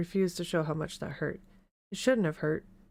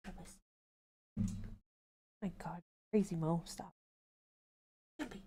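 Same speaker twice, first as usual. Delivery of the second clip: plain reading voice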